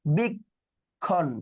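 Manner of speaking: very slow, অনুবীক্ষণ split syllable by syllable; broken into syllables. Shown as a demonstration. Teacher to students, splitting the word into syllables slowly. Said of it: "অনুবীক্ষণটা উচ্চারণ করি ভেঙে".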